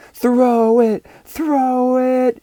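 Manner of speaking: high, loud outfield yell; shouting. Acted. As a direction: yell at your teammate to throw. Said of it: "shouted the boys in the outfield".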